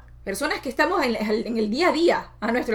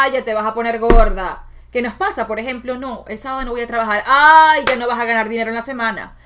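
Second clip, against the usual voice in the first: put-on loud scolding old-woman voice; grandmother's nagging voice; 7 dB louder than usual. Grandmother's voice in her head warning her, loud and nagging. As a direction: warn her off like a strict grandma. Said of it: "esa voz de mi abuela que decía".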